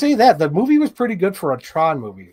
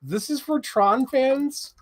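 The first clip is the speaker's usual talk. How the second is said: mock disbelief; 11 semitones higher, slower than usual.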